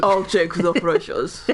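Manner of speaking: slow copycat action-star Smeagol; Arnold Schwarzenegger. Acted. Friend echoing the action-star Smeagol, slow Austrian voice. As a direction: echo it heavily in an Austrian accent. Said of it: "Smeagol from The Lord of the Rings".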